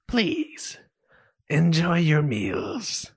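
words forced through clenched teeth; gritted teeth. Acted. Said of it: "he says through gritted teeth".

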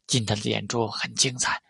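ordinary delivery

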